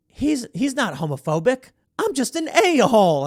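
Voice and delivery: the punchline, blurted up high; flamboyant guy's admission. Retold or imitated. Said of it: "turns around and goes".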